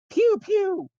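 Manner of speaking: shrill high nerd yelling pew; angry nerd shout. Acted. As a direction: yell it like an outraged nerd firing finger guns. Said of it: "in a very angry nerd voice shout".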